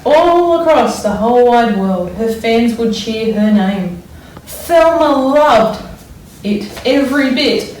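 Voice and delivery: put-on expressive read-aloud for children; story reading. A narrator's reading. Teacher reads picture book aloud.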